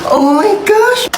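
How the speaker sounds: high, drawn-out astonishment; gasp, then stretch it out high and thrilled; slow, high-pitched awe; amazed gasp. Acted. Cheesecake surprise revealed to the child.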